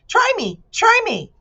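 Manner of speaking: very high, squeaky bee voice; eager bee. Acted. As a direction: beg to be tested, way up high. Bee character voice.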